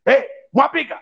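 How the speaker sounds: high, far-off bark of a father; stern father calling out. Retold or imitated. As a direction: call out like a strict old dad. Father shouting at his child.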